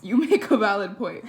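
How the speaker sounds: loud, laughing out the line; laughing harder. Real, not acted.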